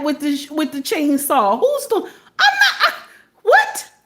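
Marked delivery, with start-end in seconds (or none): 1.6-3.9 very high, spluttering disbelief; flabbergasted. Acted. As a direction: break off in squealed mock outrage. Balks at imagined chainsaw job.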